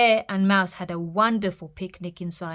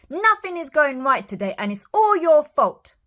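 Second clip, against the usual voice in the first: angry mouse blame; 7 semitones higher, 5 dB louder than usual. A narrator's reading. Mouse blames Bear angrily.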